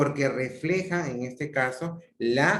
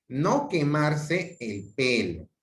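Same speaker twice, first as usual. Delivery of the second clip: slow stressed No; firm slow warning; prohibition; 2 semitones higher, slower than usual. Shown as a demonstration. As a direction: stretch out the prohibition. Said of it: "el adverbio negativo, no".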